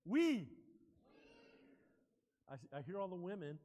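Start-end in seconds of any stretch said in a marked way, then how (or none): 0.0-0.5 louder, punched WE; strong demonstration. Shown as a demonstration. Preacher correcting a weak congregational response, strong and loud. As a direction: say WE loudly as a model to repeat. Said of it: "That's so weak".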